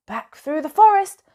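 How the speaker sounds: ordinary delivery, nothing marked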